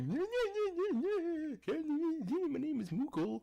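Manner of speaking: high, loud squeaky mock voice; mocking Moogle. Teasing his friend Moogle for slacking, high cartoon voice. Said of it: "you're like".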